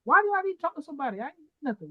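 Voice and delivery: high, indignant protest; defensive reply. Pretend. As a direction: play the touchy defensive one. Acting out defensive response.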